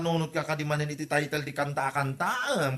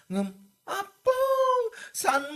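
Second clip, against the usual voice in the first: exasperated cry; much slower than usual. Acted.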